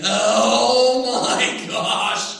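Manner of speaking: loud, thrilled gushing; kid's excitement. Retold or imitated. Boy sees his new bike, loud thrilled gushing. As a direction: gush like an eight-year-old with a dream bike. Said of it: "And I am just thinking".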